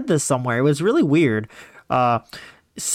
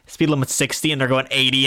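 normal talking voice

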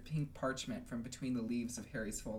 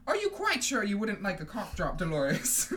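irritated offer; 6 semitones higher, 7 dB louder than usual. Acted. McGonagall snaps at coughing Umbridge.

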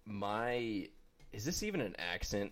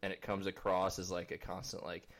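ordinary talk, nothing marked

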